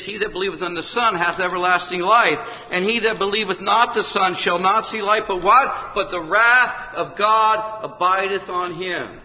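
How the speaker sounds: loud, high scripture reading, slowing at the end; preacher proclaiming John to the room; scripture declaimed. Acted. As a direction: proclaim the verse with force. Reads scripture forcefully.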